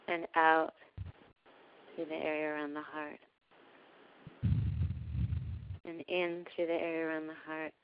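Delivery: calm, unhurried meditation voice with long pauses; guided meditation. Real, not acted. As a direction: gently guide listeners into breathing, softening as you go. Leads a heart-breathing meditation.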